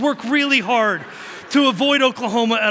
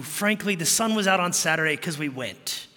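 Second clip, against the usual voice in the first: ordinary talk, nothing marked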